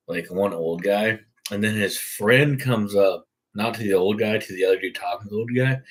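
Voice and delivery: usual speaking voice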